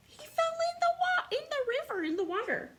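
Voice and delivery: high, quick storyteller's alarm; playful alarm. Pretend. Reader tells child of Bear's tumble, playful alarm. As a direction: squeal it out fast for a small listener. Mock alarm at bear falling in.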